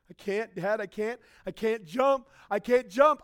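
mild mock panic at the pool edge; child freaking out. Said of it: "freaking out".

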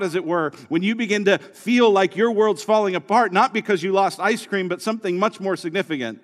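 fervent sermon delivery; preaching. Acted. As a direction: drive it home from the pulpit. Preaching at full force.

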